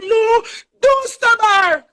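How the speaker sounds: high, drawn-out mock scream of the sister; imitating a scream. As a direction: pitch it way up and stretch it like a panicked cry. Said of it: "when she scream out".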